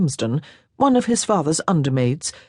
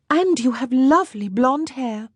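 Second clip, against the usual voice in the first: put-on lightly higher girlish voice for Jeanne; young French girl, a little higher; 8 semitones higher than usual. A narrator's reading.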